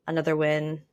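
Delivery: ordinary talk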